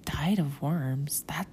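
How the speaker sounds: slow hushed whisper of disgust. Retold or imitated. Teen self reacting to the name, whispered. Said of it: "I was like".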